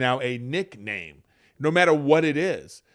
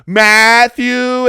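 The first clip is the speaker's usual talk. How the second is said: called-out name; 9 semitones higher, 13 dB louder than usual. Retold or imitated. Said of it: "She was like".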